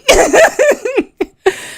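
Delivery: a loud, high giggle that falls away; real giggling fit breaking out; high burst of laughter. Real, not acted. Bursts out laughing after the protest.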